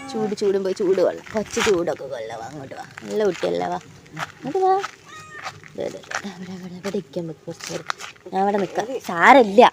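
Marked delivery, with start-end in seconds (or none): 0.1-4.7 soft coaxing. Real, not acted. Settling cat by the fire. 5.8-6.3 breathy whisper to a cat; soft whispered here, here, here; whispered coaxing. Real, not acted. Hushed pointing to the cat. 6.3-7.9 soft coaxing. Real, not acted. Settling cat by the fire.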